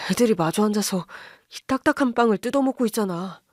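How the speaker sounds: plain reading voice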